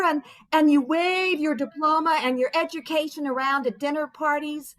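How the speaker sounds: ordinary talk, nothing marked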